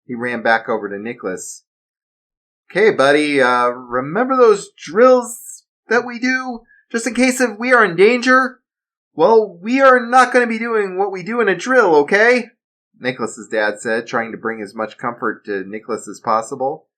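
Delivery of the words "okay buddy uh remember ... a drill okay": raised, earnest father calming his son; steady him like a worried dad. Acted. Father urging his son to remember the drill, earnest.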